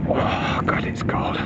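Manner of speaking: unvoiced shivering whisper. Real, not acted. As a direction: whisper it, chilled through. Freezing in the dark.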